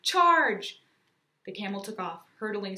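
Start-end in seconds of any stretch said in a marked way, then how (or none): 0.0-0.8 high, very loud rallying yell; battle-cry shout. A narrator's reading. Aiden on camel's back ordering attack, battle-cry shout. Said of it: "Aiden shouted, hopping onto Melchior's back".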